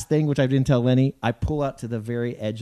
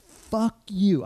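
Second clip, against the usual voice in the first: higher, drawn-out mock curse; retold angry outburst; 8 semitones higher than usual. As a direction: re-enact losing your temper, pitched up. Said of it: "I'm never mad. I go crazy".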